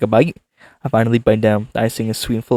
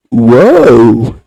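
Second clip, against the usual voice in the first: excited; 13 dB louder than usual. Acted. Excited reaction voice.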